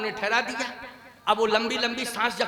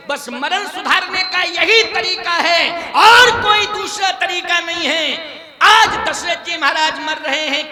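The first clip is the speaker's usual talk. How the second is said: loud, high, slowed declaration; emphatic preaching; 6 semitones higher, 9 dB louder, slower than usual. Real, not acted. Preacher tells congregation the only way, forceful loud preaching. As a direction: proclaim the moral at full force. Preacher drives the moral home.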